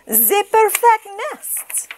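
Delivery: high, slow French duck voice; excited cry. A narrator's reading. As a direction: draw it out high in wonder. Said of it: "she cried".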